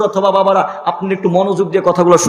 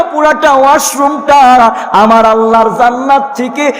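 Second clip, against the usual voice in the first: louder, slower rising praise; fervent praise; 3 semitones higher, 6 dB louder than usual. Acted. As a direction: swell with awe. Grandmother praises in chant.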